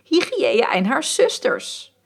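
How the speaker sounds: the painted muse retells crying out her idea to Gustav; calling out. Retold or imitated. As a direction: call it out like an invocation. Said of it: "riep ik meteen".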